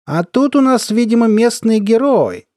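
high, loud derisive lilt; mocking. A narrator's reading. Colonel sizing up the narrator, mocking. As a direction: jeer in a high, drawn-out voice. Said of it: "сказал он насмешливо".

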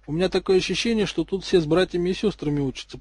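ordinary delivery

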